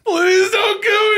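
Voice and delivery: high, shouted, sobbing beg; fake crying plea. Villain pretending to be a scared hostage, crying shout. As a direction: cry out as if begging for mercy. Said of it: "as Chad says".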